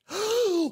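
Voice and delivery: high sudden gasp; sharp gasp. Real, not acted. Player gasping at a sudden thought, high. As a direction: catch your breath in alarm.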